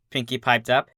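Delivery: ordinary delivery, nothing marked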